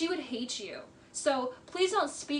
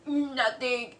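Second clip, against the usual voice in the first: mock liar's inflection. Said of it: "the inflection changes".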